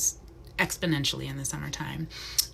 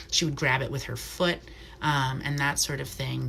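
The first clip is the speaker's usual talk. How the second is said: ordinary talk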